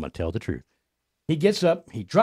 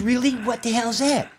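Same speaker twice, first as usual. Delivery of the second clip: high-pitched mock female query; caricatured puzzled woman, pitched high; imitated girlfriend; 12 semitones higher, slower than usual. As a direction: ask sharply in a raised girlfriend voice. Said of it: "she goes".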